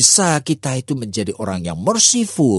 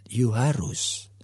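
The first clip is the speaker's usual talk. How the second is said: firm father; 2 semitones lower, 5 dB quieter, much slower than usual. Acted. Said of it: "papanya mengatakan".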